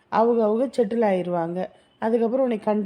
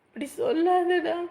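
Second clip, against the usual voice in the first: higher, slow, crying; tearful plea; 8 semitones higher, slower than usual. Acted. Girl pleading through tears. (கண்ணீருடன் கெஞ்சல்)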